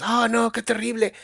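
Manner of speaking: a lightly acted alarmed thought; mock horror. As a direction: play it as a horrified inner voice. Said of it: "No es algo que en tu mente digas".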